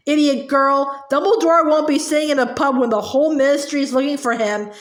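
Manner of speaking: ordinary delivery, nothing marked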